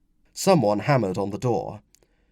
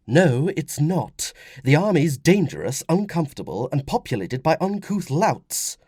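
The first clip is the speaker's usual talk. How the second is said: higher woman's voice, emphatic; objecting wife; 6 semitones higher, 4 dB louder than usual. Acted. Wife disputes army life with husband, emphatic. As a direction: argue back as a worried mother.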